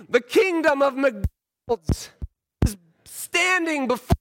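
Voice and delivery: high-pitched, impassioned plea to a son; mock preaching shout. Dad urging son to accept milk, high mock-sermon shout. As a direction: preach breakfast as if it were salvation.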